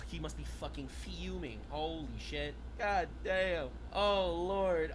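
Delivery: normal talking voice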